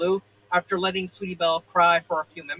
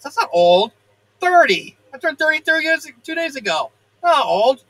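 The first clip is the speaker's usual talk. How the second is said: indignant; 7 semitones higher, 7 dB louder than usual. Real, not acted. Reader's indignant aside about age.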